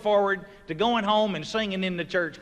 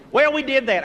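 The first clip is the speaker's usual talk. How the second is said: usual speaking voice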